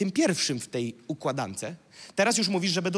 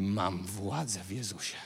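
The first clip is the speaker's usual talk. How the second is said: low, soft declaration; very soft, deep-pitched assurance; quiet authority; 10 semitones lower, 8 dB quieter than usual. Shown as a demonstration. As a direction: state it low and hushed, without any shouting. Demonstrates quiet spiritual authority.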